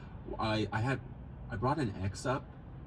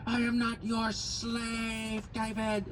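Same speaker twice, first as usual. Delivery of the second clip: crying mom; 13 semitones higher, 9 dB quieter, much slower than usual. Retold or imitated. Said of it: "She started to cry and go".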